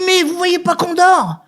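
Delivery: high-pitched mock complaint; complaining sleeper. Woken man to late-night caller, high indignant complaint.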